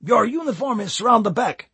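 high, pleased Cypriot boss. A narrator's reading. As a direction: order him round the back, pleased. Boss gives order, Cypriot voice.

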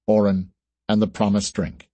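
ordinary delivery

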